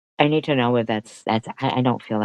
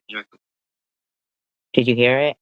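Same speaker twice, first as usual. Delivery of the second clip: normal talking voice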